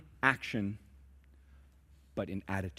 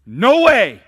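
much higher, louder "No way!" blurted; mock outraged shout; 11 semitones higher, 17 dB louder than usual. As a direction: balk at the idea out loud. Voicing congregation's refusal.

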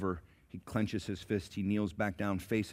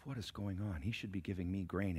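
muttered thought; 8 dB quieter than usual. Acted. Beggar's inner grumbling.

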